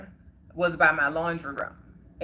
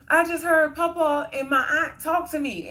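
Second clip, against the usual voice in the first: high, tearful blurt; tearful answer; 10 semitones higher, 3 dB louder than usual. Retold or imitated. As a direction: retell it as if still in tears, pitched way up. Answering mom while bawling.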